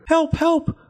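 high panicked scream of the widow; screaming for help. A narrator's reading. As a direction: shriek for help as the fox runs off. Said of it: "they screamed".

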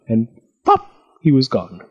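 ordinary delivery

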